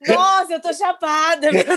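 high, laughing, amazed; stoned realization. Retold or imitated. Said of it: "eu falei".

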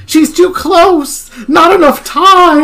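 high, loud, drawn-out yell; panicked-sounding holler; yelled warning. A narrator's reading. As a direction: yell it out in alarm, stretching the words. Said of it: "I yelled".

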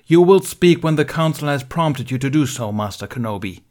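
loud, cutting order; sharp rebuke spat. Acted. Mace cutting Ben off in front of the council. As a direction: slap him down with the words. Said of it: "May spat".

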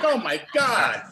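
high, drawn-out, gushing disbelief; starstruck. Retold or imitated. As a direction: gush like an amazed fan. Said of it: "she was so starstruck".

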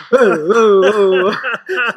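loud, high laughing whoas; rowdy cheering. Retold or imitated. Boys cheering as the coach walks in, loud and laughing. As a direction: whoop like a rowdy dressing room.

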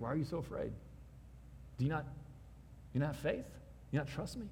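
soft, tender asking; Jesus gently questioning the disciples; gentle question. Retold or imitated. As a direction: quietly ask why they fear.

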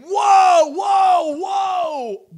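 high, loud cry of alarm; big booming angelic cry; angels shouting. Retold or imitated. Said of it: "angels saying".

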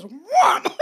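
loud, high mock yell; imitated shout. Facialist catches client leaving, imitated sharp shout.

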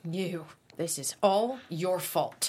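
pin the blame on her, slowly; mother accusing the daughter, menacing; menacing accusation. Acted. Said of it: "Sally points menacingly".

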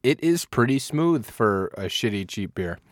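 usual speaking voice

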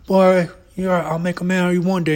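high, loud hammed-up dad scolding; angry dad. Dad chasing son in circles, hammed-up scolding. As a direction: play the gruff dad booting his son. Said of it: "It's like".

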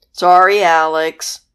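loud booming apology; hammed-up monster apology; swamp monster voice. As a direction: boom it like a big swamp monster.

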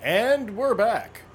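announcer voice. Acted. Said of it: "announcing return from break".

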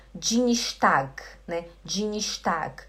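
"Dienstag" as djinishtag; own old dji. Retold or imitated. Teacher recalling her old mistake, "Dienstag" as djinishtag. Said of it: "Eu falava".

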